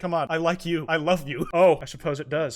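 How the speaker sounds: ordinary talk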